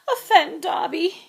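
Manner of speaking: high, loud choked cry. A narrator's reading. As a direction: choke out the words in tears. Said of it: "choked the elf".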